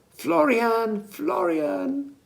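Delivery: high calling yell across the market; searching call, pitched well up. A narrator's reading. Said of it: "yelled Millie".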